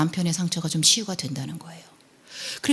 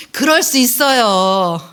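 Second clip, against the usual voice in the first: slow, louder model phrase; 5 dB louder, slower than usual. Shown as a demonstration. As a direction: stretch it out for listeners to repeat. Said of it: "우리 옆에 사람 이렇게 한번 연습해 볼까요". (천천히 따라 하게 시범 보이듯)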